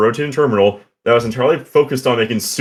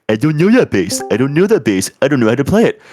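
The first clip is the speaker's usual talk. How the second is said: high, louder whiny mimicry; mocking clueless players; 5 semitones higher, 3 dB louder than usual. Raid leader mimicking people invited to an event, mock-helpless. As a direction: imitate players' helpless protest, pitched up. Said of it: "people are like".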